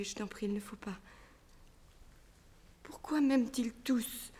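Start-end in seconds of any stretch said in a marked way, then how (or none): 0.0-1.0 pleading whisper; whispered plea. Acted. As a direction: beg him under the breath. Elle supplie en chuchotant. (supplique chuchotée)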